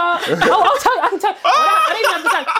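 high, laughing protest. Real, not acted. Answering the friend who teases her about the date, laughing high. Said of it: "laughing reply".

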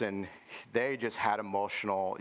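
usual speaking voice

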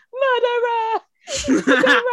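high-pitched, drawn-out protester's call; mock accusing cry. Co-host imitating someone attacking Samantha's fur coat, mock cry.

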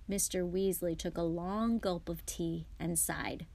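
usual speaking voice

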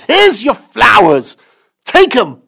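high, loud, slow huff; grumpy boy. Acted. Boy shoving grass at his mother, high loud sulk. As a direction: shove the words at her sulkily. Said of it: "shoves it towards his mother and says".

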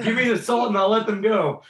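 laughing through a mock threat; laughing robber. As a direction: play the robber while cracking up. Acted robber demand.

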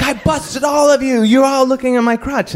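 very high sing-song callout; mock accusation. [name] catching everyone staring at him, mock accusing. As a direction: call them out in a high, sustained voice.

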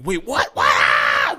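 imitated panicked shouting; losing it. Girlfriend hears her boyfriend was hit, losing it. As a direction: shout it in escalating disbelief. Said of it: "Losing her shit".

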